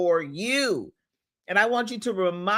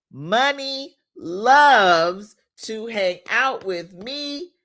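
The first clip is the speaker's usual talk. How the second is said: slow, emphatic affirmation chant; slow preacher-like affirmations; affirmation leading; 2 semitones higher, much slower than usual. Acted. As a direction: lead the congregation in declaring it, slowly. Leads affirmations for viewers to repeat.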